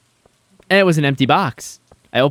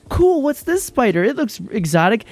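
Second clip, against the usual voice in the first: put-on higher, lightly childish wonder; young [name] marveling at the spider; excited kid voice; 8 semitones higher than usual. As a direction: gush like a little kid who just found a cool bug. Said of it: "'cause I'm like".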